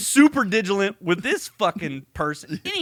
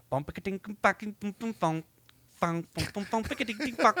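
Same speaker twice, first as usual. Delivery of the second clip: mock ringtone; 3 semitones higher, 5 dB quieter, faster than usual. Imitating phone ringtone.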